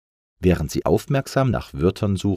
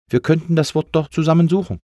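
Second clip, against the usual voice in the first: higher, louder, quick and upbeat; encouraging; 10 semitones higher, 5 dB louder, faster than usual. A narrator's reading. Lufti offers the sad A help, encouraging. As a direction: cheer your friend on with an eager suggestion. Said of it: "sagte er ermutigend zu ihm".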